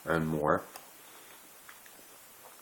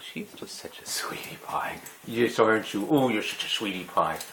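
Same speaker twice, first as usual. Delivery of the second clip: cooing at dog; 3 semitones higher than usual. Real, not acted.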